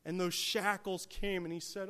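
usual speaking voice

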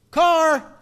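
sharp shouted warning, high and loud; yelling Car. Retold or imitated. Preacher recalls sledding, shouts the kids' warning loud. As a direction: call out Car like a kid lookout.

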